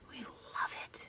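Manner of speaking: soft unvoiced whisper. Real, not acted. Vlogger gushing about night rides, whispered. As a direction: breathe it like a secret.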